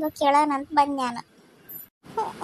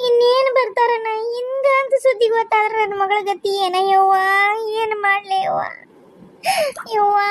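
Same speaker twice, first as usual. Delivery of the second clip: weeping lament. Acted. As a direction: pour out grief through sobs. Mother grieving dead grandchild. (ಅಳುತ್ತ ಗೋಳಾಡುವ ತಾಯಿ)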